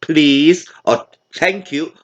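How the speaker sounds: usual speaking voice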